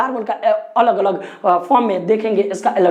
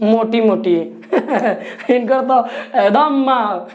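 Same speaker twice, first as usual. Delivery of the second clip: laughs through a slow joke; chuckling as he drags the words out; laughing; slower than usual. Real, not acted. Teacher cracking a joke to the class, laughing. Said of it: "joke about three girlfriends".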